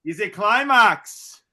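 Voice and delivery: high, loud mock Irish brogue; a rowdy Irish pub cheer in a put-on accent; hammed-up Irish accent. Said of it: "spot on Irish".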